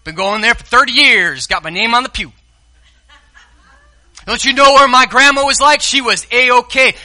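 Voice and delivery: mock self-satisfied church member; smug churchgoer. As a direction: brag about your church pedigree. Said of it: "It's like you saying".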